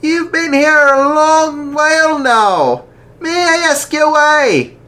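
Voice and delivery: high, loud, slow kindly priest voice; jolly archbishop. Acted. Archbishop asking the lads why, hearty and slow. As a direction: ask gently but heartily.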